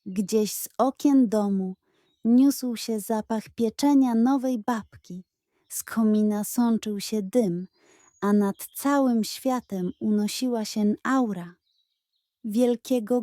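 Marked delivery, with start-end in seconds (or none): none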